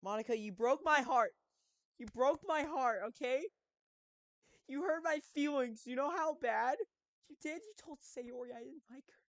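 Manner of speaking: high, loud wounded whine; whiny hurt voice. Pretend. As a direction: whine like a heartbroken kid.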